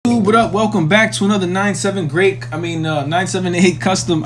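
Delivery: excited. Real, not acted. Energetic YouTube intro greeting.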